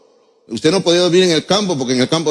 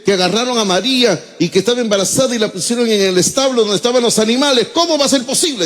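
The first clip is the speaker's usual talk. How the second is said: higher, incredulous mock outrage rising to the end; mock indignation; 7 semitones higher than usual. Preacher mimicking indignant Christians who blame the innkeeper, higher mock outrage. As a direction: play the scandalized believer, climbing in disbelief. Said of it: "Se indignan algunos cristianos".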